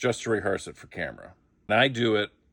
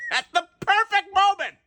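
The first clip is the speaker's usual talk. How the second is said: real high laugh spilling into the words; squealing laugh, words pitched far up. Real, not acted. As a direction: crack up mid-sentence. Laughing at his own story.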